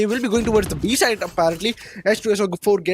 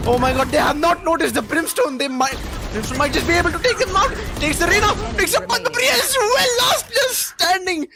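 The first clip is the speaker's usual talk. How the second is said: thrilled play-by-play; 11 semitones higher than usual. Real, not acted. Caster calling a surprise double kill, thrilled. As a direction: pitch climbing to a high, stretched cry.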